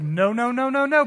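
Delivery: rapid noes climbing in pitch; mock refusal. Mary refuses to see Jesus, mock protest.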